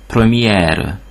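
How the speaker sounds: première with the R heard at the end; French première, open E then R; final R sounded. Shown as a demonstration. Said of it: "ha e betű is követi".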